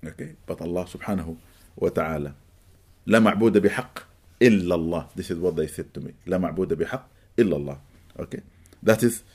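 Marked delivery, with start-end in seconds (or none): none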